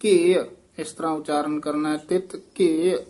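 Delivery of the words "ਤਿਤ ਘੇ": ਤਿਤ ਘੇ slow, then a pause. Shown as a demonstration. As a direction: hold ਤਿਤ ਘੇ slowly and stop. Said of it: "ਇੱਥੇ ਠਮਕ". (ਤਿਤ ਘੇ ਤੇ ਠਮਕ)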